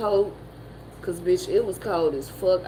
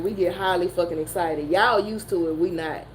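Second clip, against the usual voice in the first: normal talking voice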